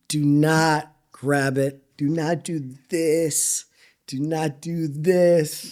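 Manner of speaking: slow, weighted "Do not do this"; drawn-out stress on each word of "Do not do this"; emphatic warning. Real, not acted. Warning young cooks, word by word.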